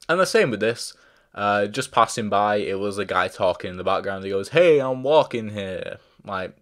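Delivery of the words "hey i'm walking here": slow, louder, higher New York "Hey, I'm walking here"; a light stab at a New York street guy; mock New York accent. A reviewer imitates a background extra, mock New York accent. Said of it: "that was not a New York accent".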